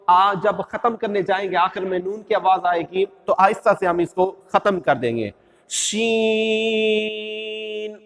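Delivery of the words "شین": شین held very long and slow, ending softly on the n; long drawn Sheen. Shown as a demonstration. Teacher demonstrating Sheen to students, drawn out slowly. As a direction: stretch شین for five alifs and let it die away. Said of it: "اس کو بھی ہم نے پانچ الف ہی کھینچنا ہے".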